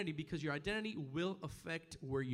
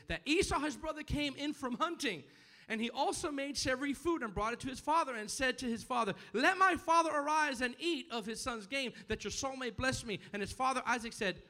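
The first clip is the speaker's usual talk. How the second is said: high, raised preacher's reading; forceful scripture reading; 7 semitones higher, 5 dB louder than usual. Acted. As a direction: proclaim the story to the congregation. Reads scripture at full force.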